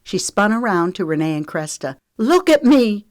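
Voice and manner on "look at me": high, loud demand; screamed. A narrator's reading. Lexi shows her jaw to girls, screaming. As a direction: scream for their attention. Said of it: "she screamed".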